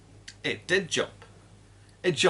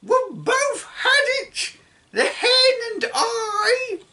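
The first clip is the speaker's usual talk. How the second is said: put-on high, loud and gleeful old woman; grandma gloating from the rooftop; cackling grandma shout; 4 dB louder, slower than usual. A narrator's reading. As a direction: crow it with a cackle. Grandma voiced throughout.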